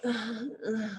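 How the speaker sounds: soft, listless grunts; imitated lethargic teens. Teens answering their guide's question, mock-lethargic. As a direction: grunt like a bored, imitated teenager. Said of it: "lethargic baseline energy of these teenagers".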